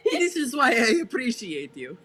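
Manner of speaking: amused appreciation, broken by laughter; real laughing. Real, not acted. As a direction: say it through giggles. Player laughing at friend's comeback.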